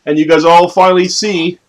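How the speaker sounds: ordinary talk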